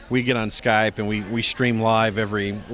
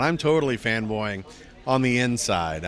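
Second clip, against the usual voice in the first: usual speaking voice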